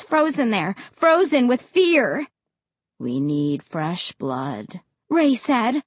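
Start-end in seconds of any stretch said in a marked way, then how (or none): 3.0-4.8 slow, low menacing voice; cold, slow threat; deep and cold. A narrator's reading. Ray's voice.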